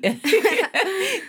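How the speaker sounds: high, open burst of real laughter; sudden high laugh at herself; bright laugh. Real, not acted. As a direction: laugh out loud, caught off guard. Host laughs at giving away plot.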